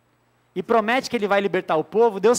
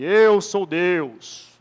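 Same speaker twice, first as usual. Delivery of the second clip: deep, very slow epic movie voice; movie trailer voice; 4 semitones lower, 3 dB louder, much slower than usual. Shown as a demonstration. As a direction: boom it out like a film trailer. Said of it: "sabe aquela voz de filme?". (voz de trailer de filme)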